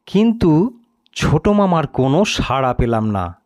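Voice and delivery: ordinary delivery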